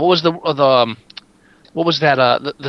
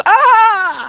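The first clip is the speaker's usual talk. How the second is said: mock crying wail; 6 dB louder than usual. Mock crying while driving.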